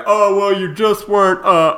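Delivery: loud, sputtering mock objection; mocking a critic. Imitating a critic caught without an answer, loud mockery. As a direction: ham up the flustered know-it-all. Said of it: "that blows your fucking theory".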